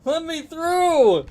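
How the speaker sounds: high frantic yell; shouting. Real, not acted. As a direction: yell it out, desperate. Frantic gaming outburst.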